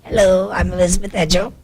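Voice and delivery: ordinary talk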